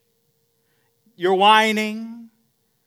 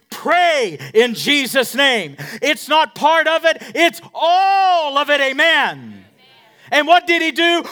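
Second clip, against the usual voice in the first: louder and higher, rising fervour; building sermon; 7 semitones higher, 8 dB louder than usual. Acted. Pastor rallying the church to pray, building. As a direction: climb to a shout.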